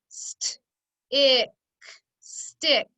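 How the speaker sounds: whispery "St-", punched "ick", then the whole "stick"; sounding out. Shown as a demonstration. Teacher doing the second syllable, sounding out STICK in parts. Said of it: "Let's do the second syllable".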